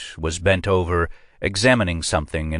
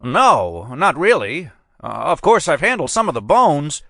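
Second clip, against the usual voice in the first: put-on much higher, flustered hedging old man; examiner hedging; 10 semitones higher, 4 dB louder than usual. A narrator's reading. Examiner hedges to the agent, high and flustered. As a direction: squirm out of it.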